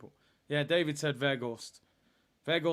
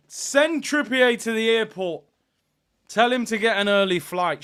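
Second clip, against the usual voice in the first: loud and higher, gloating; gleeful shout; 7 semitones higher, 9 dB louder than usual. Real, not acted. Fan gloating at a player's exit, gleeful shout. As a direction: cheer him off the pitch.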